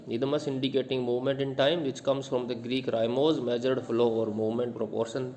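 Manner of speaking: slow, clear reading of the definition; slow dictation. Acted. Teacher dictating a definition to students slowly. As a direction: dictate each word slowly.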